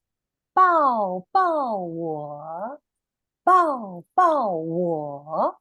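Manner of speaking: very slow, clear 抱抱我 again for repeating; slow model phrase. Shown as a demonstration. Teacher repeats the Mandarin phrase for children. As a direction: draw out each syllable of 抱抱我. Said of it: "Do you want to try".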